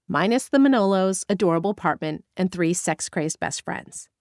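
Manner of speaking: plain reading voice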